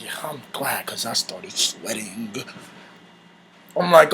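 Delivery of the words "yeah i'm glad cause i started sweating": low muttered reply. Retold or imitated. Teller's winded reply in bed.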